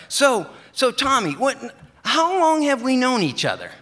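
high, loud, eager friend's voice; Brian's eager voice. Retold or imitated. Brian greets his mail carrier, high and loud. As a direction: pitch it way up and call out to your old buddy.

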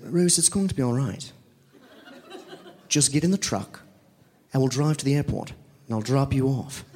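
lightly put-on British accent, calm and reassuring; a hammed-up British security guy soothing a tired friend; mild mock British reassurance; [name]'s bad British accent. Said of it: "That's a bad British".

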